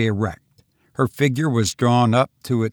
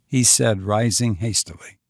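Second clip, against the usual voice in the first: ordinary delivery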